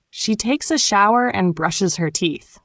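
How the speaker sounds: ordinary talk, nothing marked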